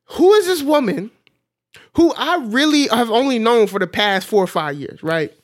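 high, loud, half-laughing disbelief; escalating high bewildered questions; incredulous wonder. Retold or imitated. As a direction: marvel out loud, almost crying with laughter. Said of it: "I'm like".

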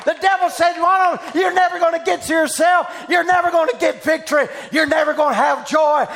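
loud revival preacher, pitch high and shouting; preaching at full force. Acted. Preacher shouting over a woman at the altar, full force. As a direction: preach it out to the whole hall.

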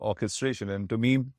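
ordinary delivery

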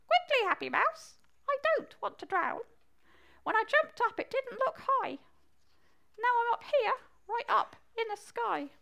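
high-pitched frog with a put-on Essex accent; slightly fake Essex. Stranded frog calls to the mouse, high fake Essex voice. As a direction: squeak it out in light mock Essex. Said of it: "With a slightly fake Essex accent".

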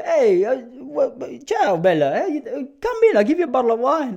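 high, loud mock Italian waiter calling out; hammed-up Italian host luring diners in; over-the-top Italian restaurateur, high and loud; Italian spruiker voice. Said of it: "I go".

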